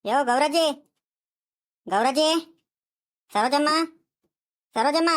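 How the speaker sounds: hailing someone at the door; calling out. Acted. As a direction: call the names out, rising at the end. Calling neighbours from outside. (ಕೂಗಿ ಕರೆಯುವ ದನಿ)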